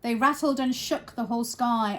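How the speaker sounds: ordinary delivery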